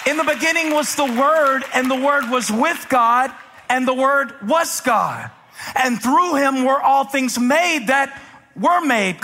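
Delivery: high, ringing pulpit delivery; full-force preaching. Real, not acted. Preacher challenging believers with scripture, full-force preaching. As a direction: proclaim it to the whole congregation.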